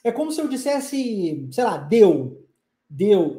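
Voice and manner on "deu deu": deu said low as a casual reduction of de eu; Brazilian deu. Shown as a demonstration. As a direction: run de eu together into deu. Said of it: "Ao invés de eu falar de eu".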